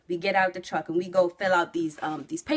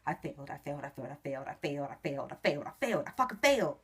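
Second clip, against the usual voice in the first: much softer, anxious repeated muttering under the breath; shaking; 4 semitones lower, 6 dB quieter, much faster than usual. Retold or imitated. Nervous driver waiting for the examiner's verdict, softly muttering in dread. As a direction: mumble it over and over, trembling. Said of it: "and I'm shaking".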